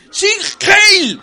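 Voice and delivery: very high, loud barked salute; mock salute shout. Presenter answering the phone in a comic retelling, mock shouting. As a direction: cry it out as a hammed-up rally salute.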